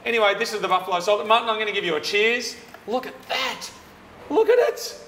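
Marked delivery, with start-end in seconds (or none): 2.9-5.0 excited. Real, not acted. Showing off the burger.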